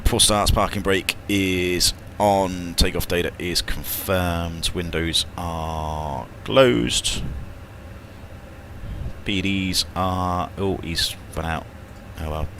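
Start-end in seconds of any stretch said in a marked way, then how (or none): 0.1-10.5 very slow, drawn-out checklist reading. Real, not acted. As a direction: stretch each item as you tick it off. Reads the checklist aloud.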